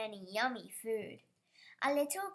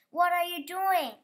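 louder, higher call; 6 semitones higher, 9 dB louder than usual. A narrator's reading. Sam calling after the dog, loud alarm. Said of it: "called Sam".

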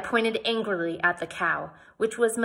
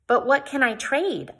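plaintive; 6 semitones higher, 4 dB louder than usual. Acted. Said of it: "I asked plaintively".

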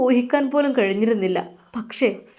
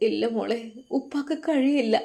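father crying; 5 dB quieter than usual. Acted. Father refusing his daughter at the gate, quietly tearful. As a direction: hold back tears as you say no. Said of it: "ആ പിതാവ് കരഞ്ഞുകൊണ്ടായിരുന്നു അത് പറഞ്ഞത്".

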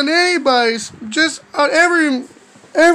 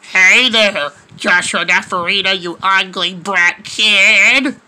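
low, drawn-out genie voice, rising at the end; mean genie; 8 semitones lower, slower than usual. Acted. Genie greeting the kid with an insult, low put-on voice. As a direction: put on a deep, taunting genie. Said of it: "the genie will be like to me".

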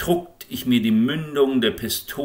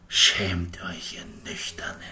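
whispered contempt for the sober; a whispered rebuke; scornful whisper; 2 semitones lower, 5 dB quieter than usual. A narrator's reading. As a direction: hiss the shame in a whisper.

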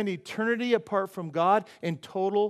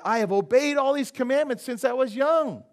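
louder, higher, emphatic claim; earnest protest; 6 semitones higher, 7 dB louder than usual. Acted. Young ruler answers Jesus, loud earnest protest. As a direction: insist on it, pitched up and loud.

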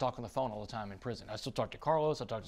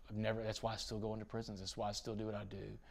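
ordinary talk, nothing marked

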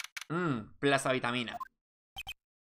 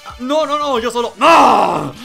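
protesta asustada; 9 semitones higher, 16 dB louder, slower than usual. Acted. Nick caught, protesting.

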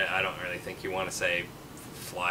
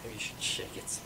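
whisper; 10 dB quieter than usual. Real, not acted. Joking whisper aside.

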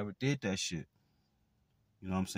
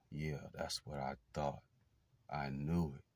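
smug hush; 5 semitones lower, 8 dB quieter than usual. Retold or imitated. Quoting abuser's smug remark.

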